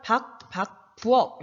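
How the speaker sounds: 박, 박, 부엌 each ending in an unreleased k; final ㄱ demonstration. Shown as a demonstration. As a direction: model 박, 박, 부엌 with a stopped k coda. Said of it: "이렇게 발음하는 거지".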